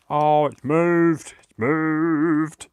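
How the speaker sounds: real shock as the paper moves; alarmed shout. Real, not acted. Craft piece suddenly shifts.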